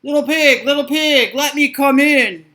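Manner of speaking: loud big bad wolf; loud wolf call. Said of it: "cried the wolf".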